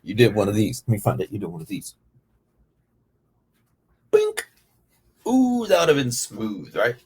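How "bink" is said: high squeaky bink; cartoon punch sound for a jab; punch sound effect. Shown as a demonstration. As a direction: pop out a high punch noise. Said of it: "Wind it up".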